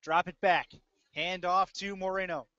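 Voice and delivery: ordinary talk, nothing marked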